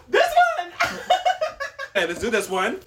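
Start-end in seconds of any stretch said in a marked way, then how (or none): 0.1-1.0 high giggly pick; laughing. Real, not acted. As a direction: laugh through the words. Laughing while picking a box.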